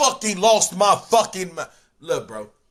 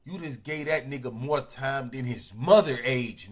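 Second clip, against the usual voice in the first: usual speaking voice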